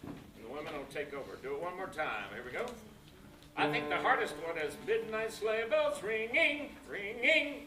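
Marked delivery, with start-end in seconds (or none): 4.9-7.6 high, loud delivery with "ringing" weighted on the -ing; heavy ending on ringing. Shown as a demonstration. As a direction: hit both syllables of "ringing" high and strong. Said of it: "not to make sound silly".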